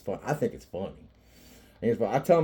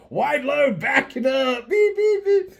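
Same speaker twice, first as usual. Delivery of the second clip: high, loud teasing call; squeaky, hammed-up reversing alarm; mock truck warning; 8 dB louder, slower than usual. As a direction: sing out like a backing-up truck. Mimics reversing truck to tease mom.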